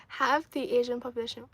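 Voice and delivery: ordinary talk, nothing marked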